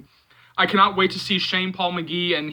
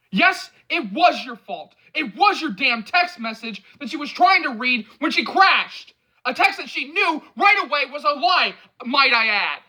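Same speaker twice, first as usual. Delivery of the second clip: angry; 9 semitones higher, 4 dB louder than usual. A narrator's reading. Angry accusation.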